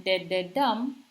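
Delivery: de-de-dum, slow, stress on the final dum; anapest demo. Shown as a demonstration. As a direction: beat out de-de-dum slowly, landing on dum. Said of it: "like in limerick".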